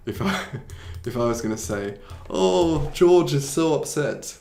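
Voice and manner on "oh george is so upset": raised, louder playful self-pity; mock third person. Shown as a demonstration. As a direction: act upset about yourself, lightly hammed. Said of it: "talking about myself".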